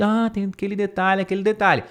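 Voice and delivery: lightly voiced student; aware student. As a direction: voice a student who noticed. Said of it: "pra você ficar ciente".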